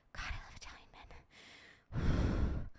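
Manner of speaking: slow, breathy whisper; hushed, drawn-out whisper of longing; whispered swoon. Real, not acted. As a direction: whisper it like a guilty confession. Swooning confession about Italian men.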